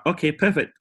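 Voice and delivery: high, smug little quote; smug mock voice. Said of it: "the guy just literally went out".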